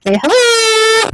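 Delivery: high, drawn-out "say hello"; cheerful call-out. Real, not acted. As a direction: sing it out to the room. Crowd greets remote viewers.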